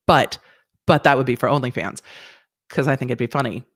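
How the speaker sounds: ordinary delivery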